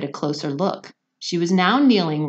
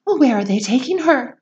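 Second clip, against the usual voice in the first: high, loud panicked cry; frightened mother shrieking at the chocolate maker; 8 semitones higher, 6 dB louder than usual. Acted. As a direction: shriek it in alarm. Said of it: "shrieked Missus Salt".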